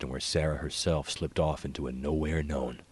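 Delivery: ordinary delivery, nothing marked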